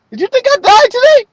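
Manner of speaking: very high, put-on asking voice; mock-innocent question. Man asking his boss about the death rumor, high mock-innocent voice.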